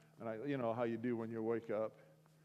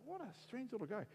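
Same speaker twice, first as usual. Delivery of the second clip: puzzled; 8 semitones higher, 9 dB quieter, faster than usual. Retold or imitated. Retelling his puzzled thought.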